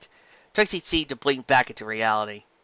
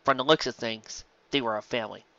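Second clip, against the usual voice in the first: ordinary delivery, nothing marked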